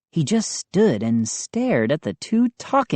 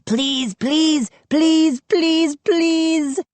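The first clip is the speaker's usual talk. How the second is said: much higher, squeaky begging; chirping pleas; 14 semitones higher, much slower than usual. A narrator's reading. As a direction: chirp each please like a little gnome.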